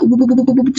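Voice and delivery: rapid stuttering "bub-bub-bub"; quick repeated lip-popping sound effect; imitated buzzing sensation. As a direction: mimic a buzz rippling through the body. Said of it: "kuping aku tuh kayak".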